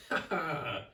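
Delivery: mocking villain's laugh; gloating laugh. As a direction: cackle at them in triumph. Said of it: "he laughed".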